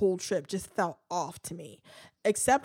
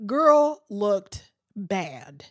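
drawn-out verdict; 4 dB louder, much slower than usual. Real, not acted. Damning verdict on outfit.

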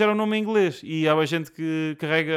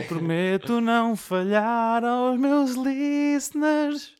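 very slow, high, louder mock-solemn promise; grand solemn vow; 9 semitones higher, 5 dB louder, much slower than usual. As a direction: draw it out like a pompous oath.

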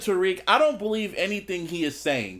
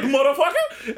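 mock disbelief; 7 semitones higher than usual. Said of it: "I was like".

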